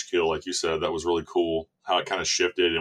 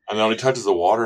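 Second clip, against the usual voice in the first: usual speaking voice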